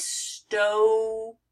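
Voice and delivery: "stow" slowly, the long O held; drawn-out syllable. Shown as a demonstration. Teacher picking out the long O in story, drawn out. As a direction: stretch "stow" as the first half of story. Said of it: "This one's hard to pick out".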